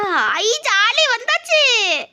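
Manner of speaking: high, loud whoop of delight; gleeful cheer. Real, not acted. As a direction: celebrate finally making it. Delight at getting through.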